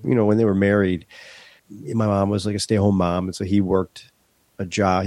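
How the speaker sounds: usual speaking voice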